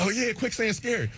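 high-pitched mock agreement; agreeing teammates. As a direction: chime in like teammates, pitched well up. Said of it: "And they're like".

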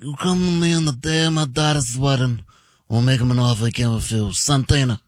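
low, mumbled mob godfather imitation; Vito Corleone. Shown as a demonstration. [name] showing off his Godfather to the host, rushed impression. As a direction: do a rushed Godfather, words running together. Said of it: "I can do good Vito Corleone".

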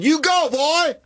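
high cheering shout; cheering call. Retold or imitated. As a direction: cheer him on from the crowd. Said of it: "I said".

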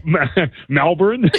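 Melbourne in an American accent, laughing. Shown as a demonstration. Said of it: "how does that American accent sound when they say Melbourne".

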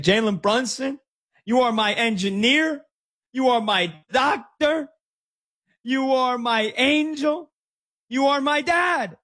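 slow, rising chant, pitch climbing with each phrase; gushing fan imitation. Host imitating a Brunson lover's social media video, gushing fan imitation.

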